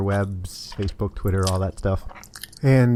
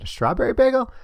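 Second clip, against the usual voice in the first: put-on higher, amused question back to a child; surprised echo; faster than usual. Man echoes the girl, amused.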